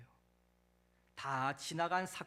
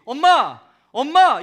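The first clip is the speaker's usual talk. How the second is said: very loud, high-pitched abrupt shouts; sudden cry for Mom; 8 semitones higher, 22 dB louder, slower than usual. Acted. Child calling a stranger in the audience Mom, sudden loud cry. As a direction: burst out calling for your mother. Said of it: "뜬금없이".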